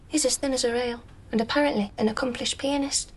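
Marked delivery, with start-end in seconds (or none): none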